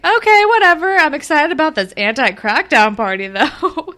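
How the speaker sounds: high, loud breezy brush-off; mock excited teen. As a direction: imitate an excited girl brushing it off. Voicing dismissive excited Shantae.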